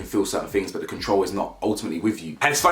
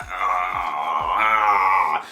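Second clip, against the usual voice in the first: long drawn-out mock "ahh"; low, dumbstruck groan, imitated; imitated awkward groan; 3 semitones lower than usual. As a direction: stall with a stretched, wordless groan. Imitating Paul sitting there dumbstruck.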